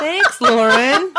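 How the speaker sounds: laughing through the words. Real, not acted. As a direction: warm chuckle, drawn out. Laughing at listener's letter.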